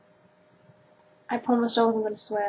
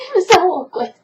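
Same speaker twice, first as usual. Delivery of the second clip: laughing; 6 semitones higher, 8 dB louder, faster than usual. Real, not acted. Laughing about awkward remark.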